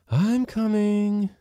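slow, drawn-out reply from off; answering call. A narrator's reading. Housekeeper answering the master's call, slow. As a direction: answer from another room. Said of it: "responded Praskovia".

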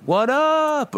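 high, held-out greeting; mock triumphant high-pitched greeting; hyped-up entrance. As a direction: make a big entrance with a high call. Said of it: "You think he was like".

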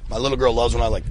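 normal talking voice

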